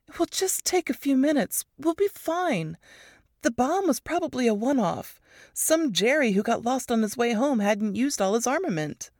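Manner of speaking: lightly voiced breezy reassurance; a hint of casual shrug; Ruth dismissive. A narrator's reading. Ruth brushing off danger.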